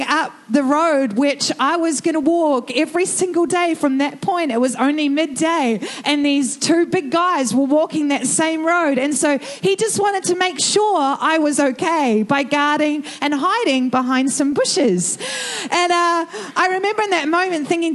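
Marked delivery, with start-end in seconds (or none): none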